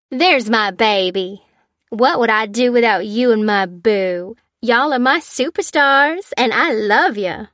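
loud, loving gush from the stage. A narrator's reading. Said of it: "gushed Tessa".

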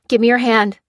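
loud urgent call. Acted. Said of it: "she called".

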